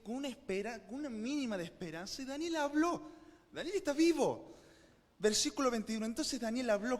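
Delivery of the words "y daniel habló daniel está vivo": high, loud joyful exclamation; excited announcement. Real, not acted. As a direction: burst out with the good news. Exclaims Daniel spoke, is alive. (anuncio emocionado, agudo y fuerte)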